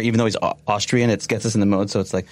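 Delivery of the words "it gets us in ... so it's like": ordinary talk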